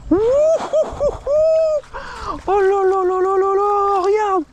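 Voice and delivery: high falsetto hoots, drawn out slowly; stretched-out high-pitched oh là là; ecstatic hooting. Real, not acted. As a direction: squeal with joy at the giant fish. Landing a giant catfish.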